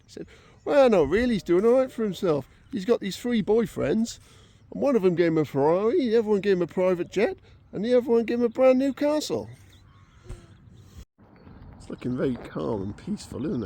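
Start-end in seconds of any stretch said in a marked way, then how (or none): 0.6-9.5 loud, raised-pitch proud retort; playing a father one-upping his friends, high and loud; acted boasting. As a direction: build through the list to a punchline. Retold father delivers boastful punchline.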